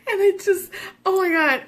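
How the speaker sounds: high giggling words; laughing while telling. Real, not acted. Mom giggles retelling her panic in the car. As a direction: talk through the giggles.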